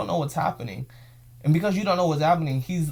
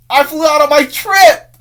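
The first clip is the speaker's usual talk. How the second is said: "I flew out on my trip!" even higher and louder, almost shouted; more excited; 12 semitones higher, 15 dB louder, slower than usual. Real, not acted.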